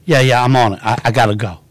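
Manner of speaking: low cop answering his radio; put-on low trooper voice; mock state trooper. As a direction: imitate an officer taking a call. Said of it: "and he was like".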